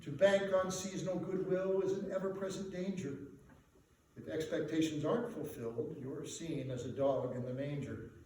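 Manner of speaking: usual speaking voice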